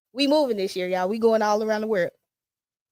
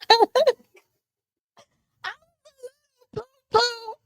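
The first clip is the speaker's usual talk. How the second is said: mock baby wail; much faster than usual. Drawn-out high childish wail.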